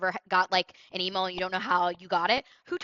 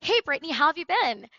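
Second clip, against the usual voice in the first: lifted, bright "Hey, Brittany", pitched high and louder; upbeat greeting; 5 semitones higher, 6 dB louder than usual. Shown as a demonstration. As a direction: sound like an old friend calling. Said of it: "You see that?".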